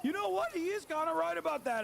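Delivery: high, loud, sudden agreement; convinced viewer. Retold or imitated. A right-winger watching the show, suddenly won over, high and loud. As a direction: pitch up and concede it loudly. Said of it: "is gonna be like".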